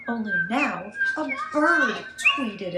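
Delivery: loud lively narration; loud chaos. A narrator's reading. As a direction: burst into the noisy scene. Arriving home to chaos again.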